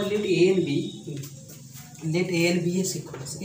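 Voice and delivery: slow and deliberate, word by word; slow dictation. Shown as a demonstration. Teacher dictating a theorem to students, slow and deliberate. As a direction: spell it out slowly for notes.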